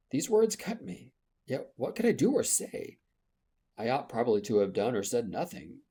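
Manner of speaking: ordinary delivery, nothing marked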